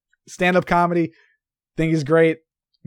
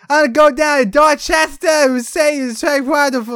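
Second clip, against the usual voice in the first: put-on drunk Boston accent; 9 semitones higher, 4 dB louder than usual. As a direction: imitate a drunk Massachusetts local. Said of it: "everyone sounded drunk".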